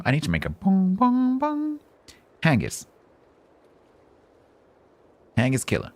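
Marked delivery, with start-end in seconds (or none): 0.0-1.8 higher, sung-out bongs; mock bell bong. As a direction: ring out like a bell.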